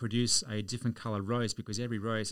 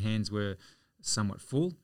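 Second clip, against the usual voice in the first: ordinary delivery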